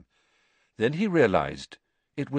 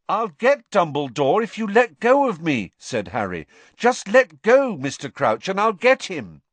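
urgent plea; 8 semitones higher, 6 dB louder than usual. Acted. Harry urging, high loud.

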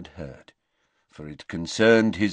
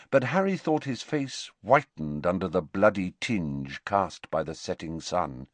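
ordinary delivery

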